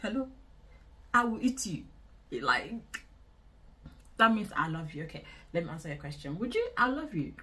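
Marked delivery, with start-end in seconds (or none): none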